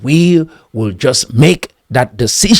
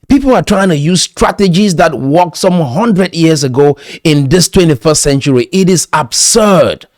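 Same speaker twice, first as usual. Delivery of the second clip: louder, higher rallying sermon voice; preaching at full force; 4 semitones higher, 4 dB louder than usual. Real, not acted. Motivational speaker rallying his listeners, preaching loudly. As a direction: preach it out to the crowd, slowing at the peaks.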